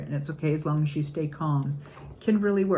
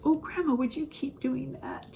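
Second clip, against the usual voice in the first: put-on high, sweet child's plea, trailing softer; lightly voiced toddler asking for more; little granddaughter; 7 semitones higher, 4 dB quieter than usual. Grandmother voicing her granddaughter's soft request. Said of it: "and she said".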